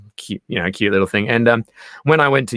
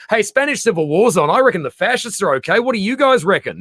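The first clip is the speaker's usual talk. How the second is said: Dali, said higher and teasing; smug provocateur; 6 semitones higher than usual. Retold or imitated. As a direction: bait the room with a cheeky grin. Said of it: "Dali comes and goes".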